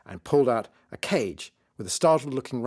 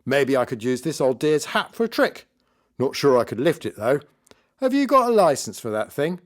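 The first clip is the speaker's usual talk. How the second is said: raised, projected stage mockery; nasty joking magician; 5 semitones higher, 5 dB louder than usual. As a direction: poke fun loudly for cheap laughs.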